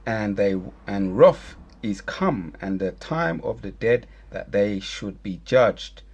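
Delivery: slow, solemn Bible reading; scripture reading. Acted. Reading Revelation to his viewers, solemn. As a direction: read the verse weighty and deliberate.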